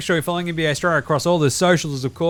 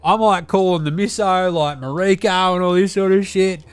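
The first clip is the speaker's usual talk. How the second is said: Deli character voice; 2 semitones higher than usual. Acted. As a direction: play the crude Aussie uncle reviewing telly. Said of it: "It's your old man Deli over here".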